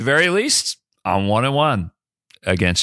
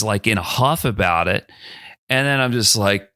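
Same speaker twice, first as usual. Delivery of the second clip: ordinary delivery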